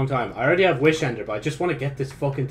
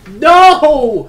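much higher, much louder sudden yell; frustrated shout; 12 semitones higher, 14 dB louder than usual. Real, not acted. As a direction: bark the no at the screen. Dies in game.

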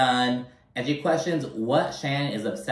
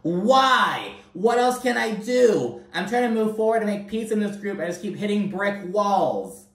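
imitating Alexis; 9 semitones higher than usual. Vlogger mimicking Alexis's frustration in a higher voice. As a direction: whine it out like a put-upon Alexis. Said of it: "She's like".

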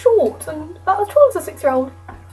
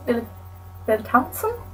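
ordinary talk, nothing marked

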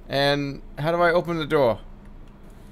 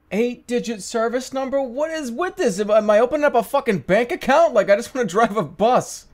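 exasperated rant; 7 semitones higher than usual. Real, not acted. Gamer ranting at the bus game's signup screen, exasperated outburst. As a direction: vent at the absurd signup form, pitch climbing.

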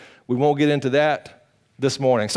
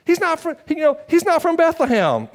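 higher mocking objector voice; lightly mocked skeptic, higher; 13 semitones higher than usual. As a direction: sneer like the doubters. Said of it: "their main arguments was".

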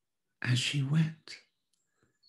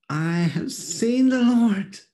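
high, loud, slow joyful announcement; excited Mary; 10 semitones higher, 7 dB louder, slower than usual. A narrator's reading. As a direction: burst out with the news as Mary.

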